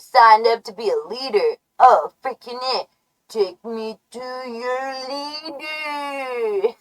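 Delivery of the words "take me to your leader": slow, soft, warped alien drone. Acted. Joking alien asking for the leader, slow drone. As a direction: stretch every word like a far-off alien.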